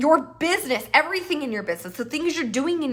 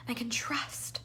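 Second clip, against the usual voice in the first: whispered, and I can trust; 12 dB quieter than usual. Real, not acted. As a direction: drop to a whisper. Soft aside.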